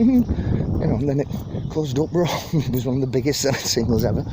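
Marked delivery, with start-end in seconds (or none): 0.0-0.8 hummed tune idea. Retold or imitated. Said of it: "He went".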